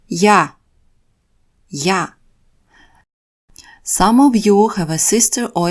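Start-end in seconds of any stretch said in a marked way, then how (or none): 0.1-2.1 я repeated clearly as "ya"; Russian я. Shown as a demonstration. Teacher repeats я for the class. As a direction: model я slowly for learners. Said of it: "Let's begin with the word me".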